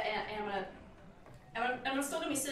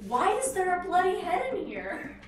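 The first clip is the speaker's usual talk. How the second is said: higher, louder, slow yell back up the hall; mock yell; 8 semitones higher, 8 dB louder, slower than usual. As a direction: call out in shock, slowly. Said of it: "I'm gonna yell back and ask".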